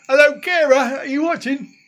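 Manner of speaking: high, loud hail; calling out. Real, not acted. Host hails a viewer who may be watching, calling out. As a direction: call out to someone who may not hear.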